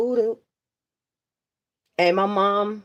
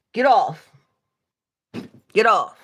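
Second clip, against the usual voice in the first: firm get off; 3 semitones higher, 4 dB louder than usual. Real, not acted. Ordering her off the live.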